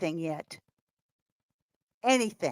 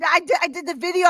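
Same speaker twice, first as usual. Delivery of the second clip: ordinary talk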